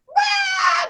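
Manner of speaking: high, loud mock cry; bawling toddler wail. Toddler wailing once mother panics, mock bawling. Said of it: "they're bawling".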